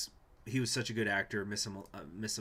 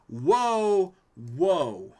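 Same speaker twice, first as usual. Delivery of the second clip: a much louder, higher whoa dropping off; startled whoa; 7 semitones higher, 10 dB louder than usual. Real, not acted. Reacting to an event on screen, loud startled whoa. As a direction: jolt back at sudden news.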